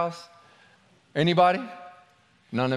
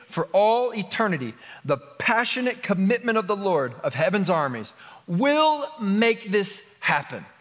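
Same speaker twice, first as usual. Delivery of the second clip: higher, emphatic proclamation of prophecy; scripture reading; 4 semitones higher than usual. Real, not acted. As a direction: proclaim it with long stretched key words. Reads scripture aloud.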